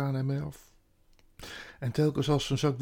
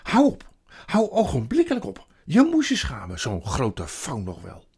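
exasperated yelling; shouted scolding; 9 dB louder than usual. A narrator's reading. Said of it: "schreeuwde Lucie. Ze schudde hem".

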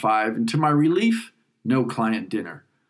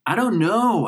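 called back; 8 semitones higher, 3 dB louder than usual. Acted. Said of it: "I called back".

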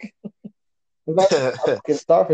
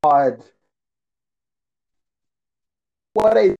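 bad connection; 5 dB louder, slower than usual. Real, not acted. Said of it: "You're breaking up".

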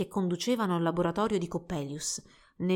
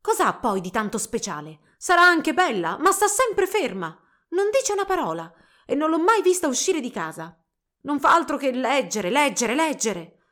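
raised, loud jealous complaint; jealous outburst; 4 semitones higher, 6 dB louder than usual. A narrator's reading. Girl running down her rival to her sweetheart, jealous. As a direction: scoff jealously at the rival, dragging out the last words. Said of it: "strillò scoppiando di gelosia".

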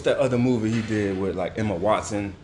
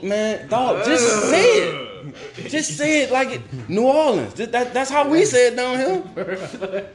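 laughing exasperation; 10 semitones higher, slower than usual. Real, not acted. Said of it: "exasperated laughing rant".

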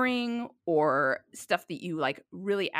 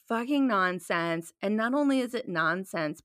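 ordinary delivery

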